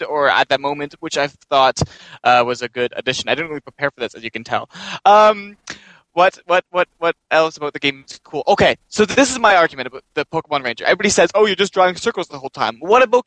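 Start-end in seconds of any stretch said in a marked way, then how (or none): none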